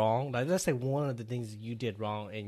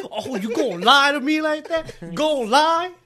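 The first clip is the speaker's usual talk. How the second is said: high, loud confrontational challenge; mock gangster; 13 semitones higher, 12 dB louder than usual. Dad confronts lying kid, mock gangster outrage. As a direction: play it hammed-up gangster tough. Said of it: "Get all gangsta up, like".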